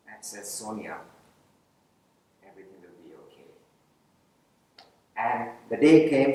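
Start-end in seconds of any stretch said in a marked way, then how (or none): 0.6-3.5 low, soft, calm fatherly promise; calm reassurance. Retold or imitated. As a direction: gently soothe a worried young man. Principal's reassurance repeated to Sonia.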